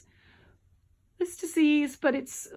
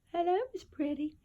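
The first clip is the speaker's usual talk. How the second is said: greeting the cat; 6 semitones higher than usual. Real, not acted.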